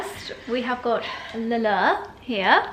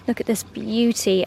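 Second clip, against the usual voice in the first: ordinary talk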